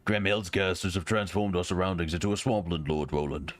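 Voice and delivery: a hint of a stiff military officer reporting; lightly acted knight briefing his lord; lightly voiced soldier. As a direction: report the battle like a loyal retainer. Voices game officer reading dialogue.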